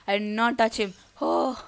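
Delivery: ordinary talk, nothing marked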